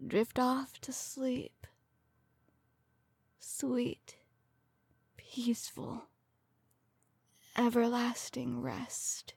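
whispering. Acted. Lulling victim to death.

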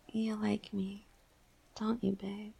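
soft intimate whisper. Acted. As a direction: coax it out in a whisper.

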